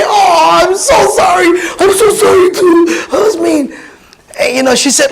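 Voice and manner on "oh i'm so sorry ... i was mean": crying apology. Retold or imitated. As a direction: sob out the apology, overwhelmed. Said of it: "we both started crying".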